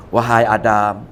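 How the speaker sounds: deep, slow, sly devil voice; a low, drawn-out call from the devil; Iblis tempting. Acted. Preacher voicing Iblis luring Adam, deep sly tempter. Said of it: "Tengok iblis ni".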